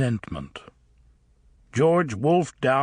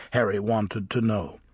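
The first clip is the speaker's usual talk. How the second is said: ordinary delivery, nothing marked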